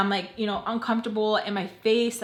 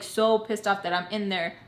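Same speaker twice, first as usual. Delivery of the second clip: normal talking voice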